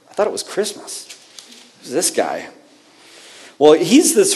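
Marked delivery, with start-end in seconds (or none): 0.1-2.5 confused whisper. Pretend. Voicing confused listener.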